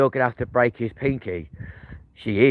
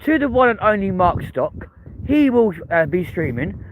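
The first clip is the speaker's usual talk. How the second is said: hype announcer; 9 semitones higher than usual. Acted. Hyping Mark to Danny.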